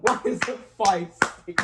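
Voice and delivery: ordinary delivery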